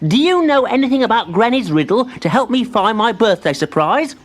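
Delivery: put-on high, eager child-like voice; young tree voice. A narrator's reading. Timbertwig thanks his spider friend, high and eager. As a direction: sound small and pleased.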